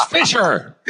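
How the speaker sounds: high-pitched mock shout of a boss; imitating a yelled summons; retold yell. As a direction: call out the name like an angry boss, not at full volume. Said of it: "he starts yelling at me".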